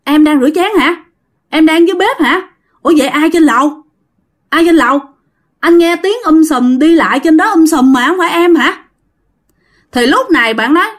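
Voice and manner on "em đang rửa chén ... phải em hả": higher, alarmed questions tumbling out; a husband suddenly spooked, asking fast; husband startled to find his wife downstairs. Retold or imitated. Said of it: "chồng bạn hết hồn nói".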